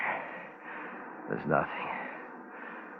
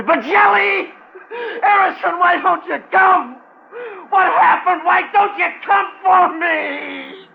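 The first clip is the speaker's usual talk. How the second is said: much higher and louder, sobbing cries; desperate crying shout; 10 dB louder, slower than usual. Acted. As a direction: call out for help, breaking down. Stranded man calling out for rescuers.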